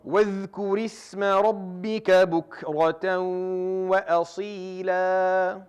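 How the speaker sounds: slow, melodic tajweed chanting with long held vowels; Quran recitation. Acted. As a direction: recite each verse in a drawn-out chanted melody.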